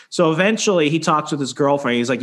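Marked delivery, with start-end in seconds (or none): none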